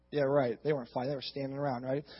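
low, hushed, skeptical aside; sarcastic aside. Real, not acted. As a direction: drop it down to a dry, doubting undertone. Sarcastic aside doubting the story.

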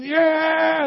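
long mock war-cry "Yeah!"; imitated shouting. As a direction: shout it out like a noisy army.

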